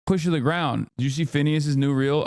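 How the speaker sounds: ordinary talk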